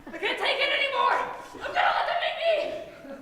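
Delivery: high, loud, half-laughing breakdown; hammed-up panic, shouted high; hysterical outburst. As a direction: lose it completely.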